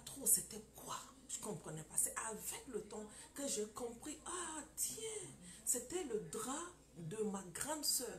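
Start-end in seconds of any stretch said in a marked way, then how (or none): 4.2-5.3 a higher, softer "ah" of discovery; as if it just dawned on her; remembering the moment she understood, surprised; sudden realization. Retold or imitated.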